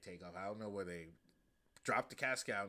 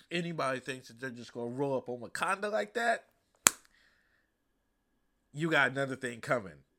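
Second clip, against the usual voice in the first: loud, higher, defiant voice; defiant warning; 4 semitones higher, 9 dB louder than usual. Retold or imitated. Queen defying the council, loud and defiant. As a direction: throw the challenge back at them. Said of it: "And Angela Davis is like".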